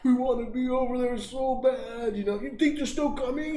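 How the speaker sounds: put-on high, pleading childish whine; whiny little kid. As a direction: beg like a sad little boy. Kids whining to dad.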